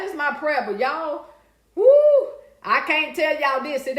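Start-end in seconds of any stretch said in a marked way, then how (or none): 1.7-2.3 high, loud whoop; excited whoo on the stream. Real, not acted. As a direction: let out a whoop. Excited exclamation.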